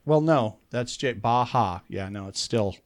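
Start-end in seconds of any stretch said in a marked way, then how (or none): 1.1-1.8 Baja with the J breathed as an H. Shown as a demonstration. Countering the co-host's theory, Baja said with an H. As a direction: sound the middle of Baja as "ha". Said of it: "it's still an H sound".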